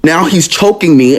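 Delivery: normal talking voice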